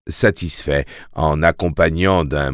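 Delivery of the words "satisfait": usual speaking voice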